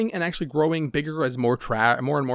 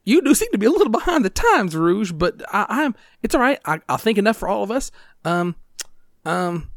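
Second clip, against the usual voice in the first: high, loud dithering character voice; hesitant character, high and forceful; flustered game character; 9 semitones higher, 5 dB louder than usual. Acted. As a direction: stammer along in a pitched-up voice.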